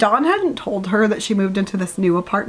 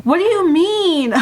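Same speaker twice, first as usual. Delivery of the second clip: incredulous; 10 semitones higher, 3 dB louder than usual. Real, not acted. Incredulous at the book.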